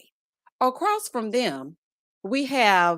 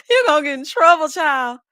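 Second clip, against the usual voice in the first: fast, loud teasing through laughter; laughing tease; 4 semitones higher, 7 dB louder than usual. Real, not acted. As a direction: tease her while still laughing. Teasing viewer after laughing.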